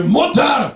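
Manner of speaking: "Mutter" shouted hard like a drill order; barked German. Shown as a demonstration. A comic mocking harsh German for the crowd: barked shout. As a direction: snap "Mutter" out like a command. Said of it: "you have to raise your right hand".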